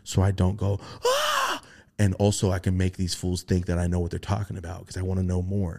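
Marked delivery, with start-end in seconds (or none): 1.0-1.6 high squeaky mock yelp; a sudden high yelp of alarm; startled yelp. Catching himself before yelping at the beings, high yelp.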